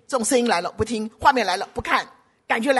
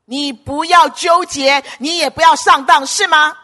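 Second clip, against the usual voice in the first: louder, higher, insistent warning; forceful exhortation; 5 semitones higher, 8 dB louder than usual. Real, not acted. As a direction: drive the warning home loudly. Preacher exhorting congregation forcefully. (高声劝诫)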